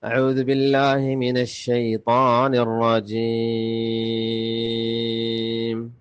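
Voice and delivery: slow, melodic tajweed chant with long held vowels; Quran recitation. Shown as a demonstration. Teacher recites the verses for learners, measured Quranic chant. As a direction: chant each phrase in sustained tones with full pauses.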